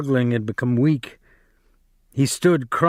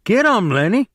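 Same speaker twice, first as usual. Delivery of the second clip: higher, louder shout through cupped hands; yelled; 9 semitones higher, 4 dB louder than usual. Acted. George cupping his hands, yelling to Lennie, high and loud. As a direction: call him on across the room.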